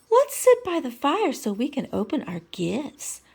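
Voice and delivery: a lightly voiced diva pig, purring; Miss Piggy voice. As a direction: coo the invitation like a pampered starlet. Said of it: "Let me do this in my Miss Piggy voice".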